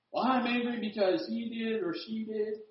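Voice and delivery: high, soft mock whine; complaining child. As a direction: play a kid passing the blame. Acts child blaming others again.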